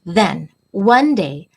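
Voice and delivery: put-on reading to children. A narrator's reading. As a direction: read it like storytime for little kids. Reads picture book to class.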